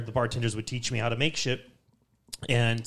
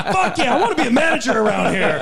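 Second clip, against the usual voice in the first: gleeful, laughing through it, higher and louder; 10 semitones higher, 8 dB louder than usual. Real, not acted. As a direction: crack up as you cheer. Laughing while voicing his glee.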